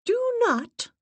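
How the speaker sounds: high, sharp schoolmistress warning; stern headmistress. A narrator's reading. Assistant warns the séance circle like a headmistress. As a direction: scold like a strict headmistress. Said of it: "in a tone that reminded me of the headmistress".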